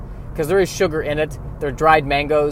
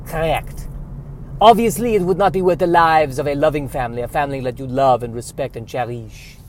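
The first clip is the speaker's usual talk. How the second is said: put-on French Canadian accent. Trader justifying the trade.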